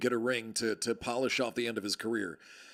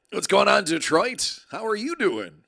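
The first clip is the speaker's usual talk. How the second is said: calling out hello; 7 semitones higher, 6 dB louder than usual. Acted. Calling out a greeting to Detroit.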